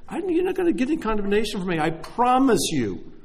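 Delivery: loud, high earnest pledge; earnest promise. Real, not acted. Preacher assuring the congregation there's no condemnation, loud and earnest. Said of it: "earnest loud promise".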